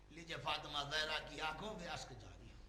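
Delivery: normal talking voice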